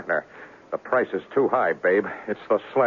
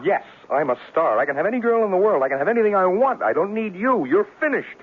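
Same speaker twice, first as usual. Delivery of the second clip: jealous shouting; 10 semitones higher than usual. Acted. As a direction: throw her away in anger. Jealous quarrel with Judy.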